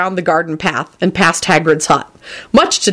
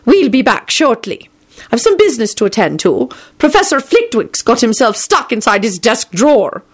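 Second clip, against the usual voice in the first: brisk McGonagall; 5 semitones higher than usual. Acted. McGonagall announces she is leaving.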